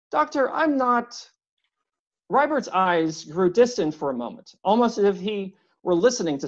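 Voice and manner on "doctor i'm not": lightly voiced big man, higher and earnest; a gentle giant trying to please; courteous stranger at the door, a little anxious; eager polite visitor. A narrator's reading. Narrator voices visitor Rybert.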